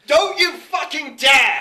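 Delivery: loud, slow mock-furious shout; angry shout. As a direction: bellow a playful threat, slowly. Friend forbidding a dreaded pick.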